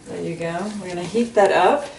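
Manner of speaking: ordinary delivery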